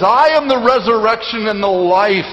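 strong, declarative scripture quote from the pulpit; ringing proclamation. Acted. Proclaiming Christ's words to congregation.

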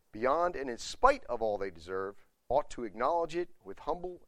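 measured public reading with strong key words; formal reading aloud. Real, not acted. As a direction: read the article out firmly to the room.

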